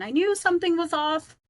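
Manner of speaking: ordinary delivery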